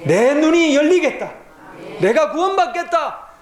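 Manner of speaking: high pleading shout; desperate crying out. Acted. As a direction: plead at the top of your voice. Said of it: "소리 질러요".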